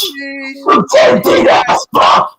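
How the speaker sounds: hoarse screaming through sobs; raging scream. Real, not acted. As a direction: scream until the voice gives out. Screaming at father and stream.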